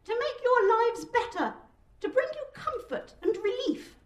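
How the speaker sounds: high-pitched appeal to a crowd; weedy speechmaker pleading his case; weedy, thin oratory. A narrator's reading. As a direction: strain to be heard, thin and high. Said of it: "his delivery is weedy and thin".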